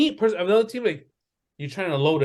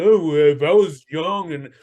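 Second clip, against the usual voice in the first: lower, fast, mocking whine; mock whiny player; 5 semitones lower than usual. Host mocks a veteran's excuses in a whiny voice. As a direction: sneer out the aging star's excuses. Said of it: "so you could be all bitchy".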